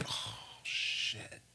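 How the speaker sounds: whispered, breath-out oh-no, retold; hushed mock dread; sinking realization. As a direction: let it out as a voiceless sigh of dread. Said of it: "automatically I was like".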